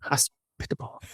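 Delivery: voiceless whisper of the word; whispered to self. Real, not acted. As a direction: whisper it to yourself. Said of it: "Hospitable, hospitable".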